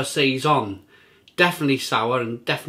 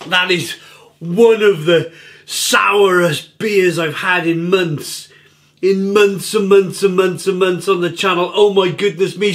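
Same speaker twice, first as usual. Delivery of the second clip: amazed; 3 semitones higher, 5 dB louder than usual. Real, not acted. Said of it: "it's so sour".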